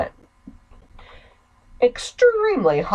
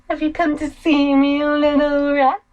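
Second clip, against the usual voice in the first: sing-song menace, higher and louder; creepy taunting sister; 4 semitones higher, 4 dB louder than usual. Acted. Sister taunting her brother as a rat, sing-song. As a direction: toy with him like prey.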